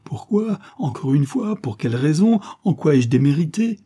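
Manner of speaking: high, loud, anguished questioning; desperate demand. Acted. Rejected lover demands a reason, desperate. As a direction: press urgently for an answer.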